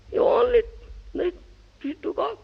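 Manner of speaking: plain reading voice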